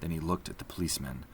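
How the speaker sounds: ordinary delivery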